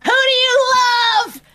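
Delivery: high, loud sing-out over the intercom; intercom call-out. Acted. Hacker coming over the intercoms, high loud call-out. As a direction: belt it out as a taunting announcement. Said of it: "come over the intercoms and be like".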